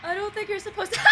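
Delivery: high, loud words through laughter; laughing at the absurd plea. Real, not acted. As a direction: giggle it out in disbelief. Laughs at the game's request.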